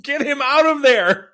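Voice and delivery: high-pitched urgent yell; high comic outburst; mock alarm. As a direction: call out in exaggerated exasperation. Mock yelling at the coach.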